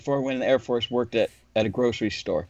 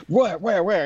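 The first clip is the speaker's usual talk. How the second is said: mock panic; 7 semitones higher than usual. Rick panics over truck scratch.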